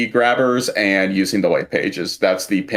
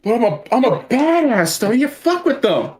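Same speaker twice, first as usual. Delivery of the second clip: mock badass; 13 semitones higher than usual. Friend mocks the badass bunny.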